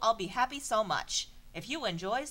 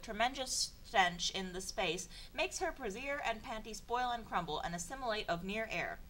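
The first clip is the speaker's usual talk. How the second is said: normal talking voice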